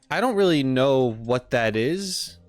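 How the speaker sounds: usual speaking voice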